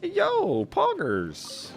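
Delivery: high, loud whoop of delight; thrilled gamer's cheer; excited cheer. Real, not acted. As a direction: shout it high, then let the pitch drop. Streamer cheers a game reward.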